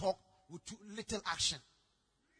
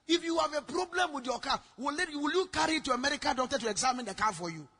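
raised, loud indignant challenge; angry scolding; 4 semitones higher, 8 dB louder than usual. Retold or imitated. Preacher scolding the woman for questioning him, angry scolding. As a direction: hammer the questions at her, re-enacting anger. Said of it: "She saw my anger".

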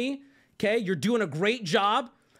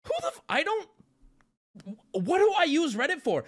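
retold disbelief; 8 semitones higher than usual. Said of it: "So I'm like".

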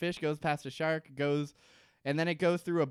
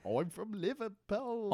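higher, softly hammed-up Liverpool accent; attempted Liverpool accent; 6 semitones higher, 4 dB quieter than usual. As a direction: try a bad Liverpool accent. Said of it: "I can't, I can't do an accent".

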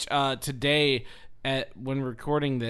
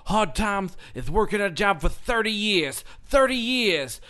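Dusty Rhodes impression; 5 semitones higher, 5 dB louder than usual. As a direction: belt it like a wrestling promo. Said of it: "The crux of the whole thing was".

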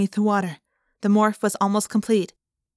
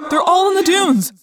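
high, loud urgent cry; urgent shout; 10 semitones higher, 7 dB louder than usual. A narrator's reading. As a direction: call out the warning. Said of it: "Tobias cried".

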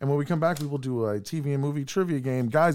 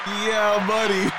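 hype shout; 9 semitones higher, 3 dB louder, much slower than usual. Pretend. Buzzer sound demo again.

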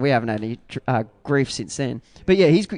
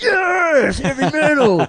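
high, loud mock triumph; yes, heavy metal, punched out high; excited cheer; 13 semitones higher, 6 dB louder, slower than usual. The band agreeing on the name, high excited cheer. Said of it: "And we're like".

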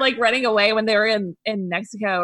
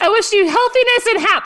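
high, loud botched greeting; hammed-up flustered slip of the tongue; mock fumbled recital; 13 semitones higher, 7 dB louder than usual. Joking about a botched gift greeting, high mock fluster.

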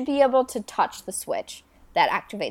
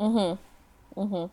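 ordinary talk

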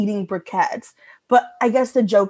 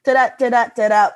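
punched "da-da" beats, a little louder; rhythmic da-da pacing; 4 dB louder, faster than usual. Shown as a demonstration. Repeating the joke's beats for co-hosts, rhythmic da-das. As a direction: beat out the rhythm in paired syllables. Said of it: "that's, like, what it is".

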